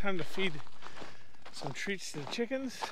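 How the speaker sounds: winded after the hill; breathing hard between words. Real, not acted. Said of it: "I'm a little, little out of breath".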